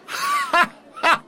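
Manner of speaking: high hooting laugh; mocking laugh. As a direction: burst out laughing at him.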